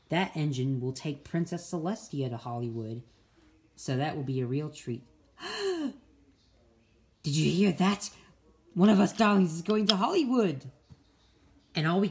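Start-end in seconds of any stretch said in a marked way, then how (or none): 5.4-5.9 sharp, high gasp of delight; excited gasp. Acted. Engine hearing the Hollywood prize, gasping. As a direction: catch your breath at the big news.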